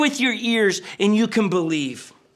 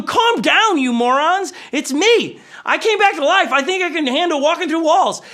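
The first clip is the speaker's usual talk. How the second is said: high-pitched comic scolding; mock exasperated Jesus; 7 semitones higher than usual. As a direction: hush the panicking disciples, pitched high and half-joking. Jesus soothing panicked disciples, acted comically.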